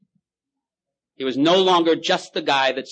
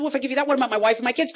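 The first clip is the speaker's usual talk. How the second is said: much higher, fast desperate plea; desperate drunk; 14 semitones higher, 3 dB louder, much faster than usual. Acted. Drunk begging to keep his family, much higher and fast. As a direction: rush out the plea. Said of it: "He said".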